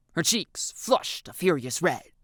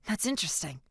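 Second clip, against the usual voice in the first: hushed; 2 semitones higher, 6 dB quieter than usual. A narrator's reading. Hushed exchange in class.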